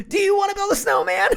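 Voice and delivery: very high, loud squeaky put-on voice; goofy cartoon voice. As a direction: squeal the movie line like a cartoon. Quotes Frozen in goofy voice.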